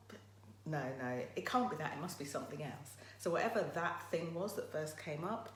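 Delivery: normal talking voice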